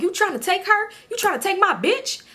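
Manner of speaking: high, indignant questioning; put-on affronted voice; mock challenge. Uncle's mock challenge in retold exchange.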